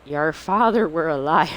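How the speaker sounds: hammed-up pirate with a Southern twang. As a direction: taunt them like a Southern pirate. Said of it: "Southern pirate".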